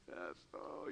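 low, soft, imitated tearful reply; mock tearful. Retelling Rhonda admitting her daughter troubles, mock tearful. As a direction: barely voice it, as if choked up. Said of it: "yeah, like, tears".

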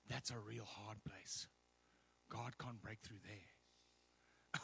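low, hushed, slowing warning; imitated gloomy doubter. People warning the preacher about hard places, imitated grave hush. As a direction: drop it low and soft like a naysayer.

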